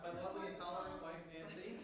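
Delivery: distant, off-mic voice; speaking too far from the microphone; away from the mic. Real, not acted.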